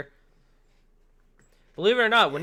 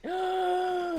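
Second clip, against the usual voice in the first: dramatic gasp; 14 semitones higher, 4 dB quieter than usual. Acted. Mock outrage at a friend.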